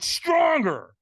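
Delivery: loud retold command; trainer's demand. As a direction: urge it out loudly like a coach. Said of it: "And he went".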